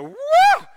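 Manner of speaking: a sudden high, loud mock yelp of fright; startled yelp. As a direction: yelp like a spooked disciple. Disciples' startled reaction acted.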